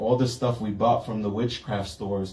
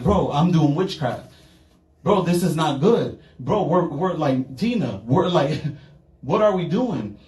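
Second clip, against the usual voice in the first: alarmed; 6 semitones higher, 3 dB louder than usual. Retold or imitated. Panicked realization, laughing.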